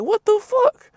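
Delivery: baffled shopper's squeak; surprised. Retold or imitated. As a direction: jump up in confused disbelief. Confused by woman blocking him.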